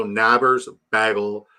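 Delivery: "Nabbers, bagel" both with the short a of "nab"; imitated vowel mispronunciation. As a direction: say "bagel" as "baggle", matching "Nabbers". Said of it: "the vowel A".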